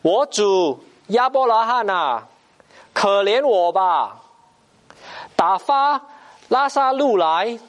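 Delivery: tormented soul crying out to Abraham; pleading cry from hell. Acted. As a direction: beg for mercy from the fire, stretching the words. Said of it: "他就喊着说".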